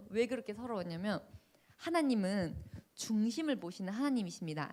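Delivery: usual speaking voice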